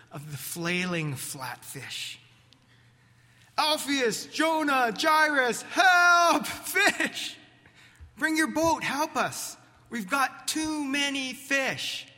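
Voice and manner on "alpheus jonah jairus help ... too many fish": shouting for help. Acted. Calling other boats for help.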